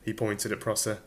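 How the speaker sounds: plain reading voice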